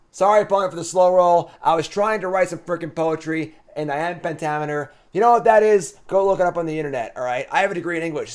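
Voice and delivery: mock rant. Real, not acted. Gamer defending his iambic pentameter to the opponent, loud mock rant. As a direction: rant about the English degree, half joking.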